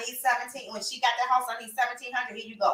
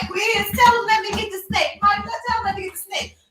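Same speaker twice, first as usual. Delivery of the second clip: high, loud pleading; begging to be allowed the snake; 10 semitones higher, 5 dB louder than usual. Retold or imitated. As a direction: beg everyone, much higher. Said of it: "I'm getting everybody".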